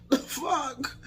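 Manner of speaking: high, squeaky mock disbelief; incredulous. Reacting to invitation onto a lap, incredulous. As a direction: retell it as a high-pitched baffled reaction.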